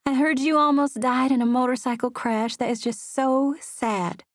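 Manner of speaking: a touch of Southern-belle cooing; cooing Southern accent. A narrator's reading. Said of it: "she cooed in her smooth Southern accent".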